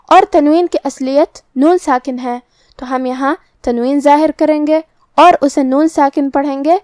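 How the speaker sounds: normal talking voice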